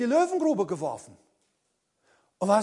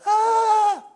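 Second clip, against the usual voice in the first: startled yelp; 11 semitones higher, 6 dB louder than usual. Retold or imitated. Mocked reaction to an insult.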